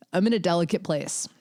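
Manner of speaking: normal talking voice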